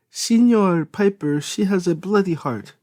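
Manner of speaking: put-on high, slow Italian-accented plea. A narrator's reading. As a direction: Sophia cuts in with an Italian lilt. Sophia interjects with foreign address.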